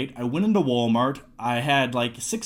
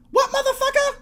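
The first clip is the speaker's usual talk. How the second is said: shrieking far higher, louder challenge; Samuel L. Jackson impression; 3 dB louder than usual. Shown as a demonstration. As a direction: fire it back in a very high, loud indignant yell. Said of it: "the impressions don't get better".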